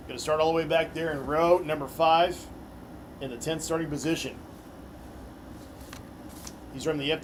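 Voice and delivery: arena driver introductions; announcer. Acted. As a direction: hype each name for the crowd.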